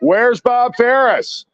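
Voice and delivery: high, slow call across the street; repeating his earlier call to the crowd, high and slow; called out question. Retold or imitated. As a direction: call out the name, drawn out. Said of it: "I was asking".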